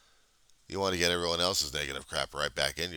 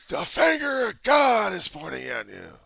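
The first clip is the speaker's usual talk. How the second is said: high, loud shouted heckler voice; mock preacher heckler; 10 dB louder than usual. Heckler condemning him, shouted mock voice.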